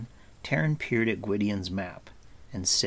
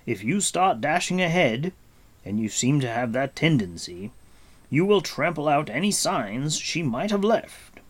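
higher, emphatic caution; Gwydion; 6 semitones higher, 4 dB louder than usual. Acted. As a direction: admonish a rash youth firmly.

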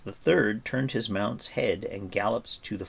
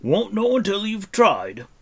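high, loud gruff dwarf; grunted; 12 semitones higher, 7 dB louder than usual. Acted. Dwarf answers Taran's doubt, grunting. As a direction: grunt it out loudly. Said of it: "grunted Dolly".

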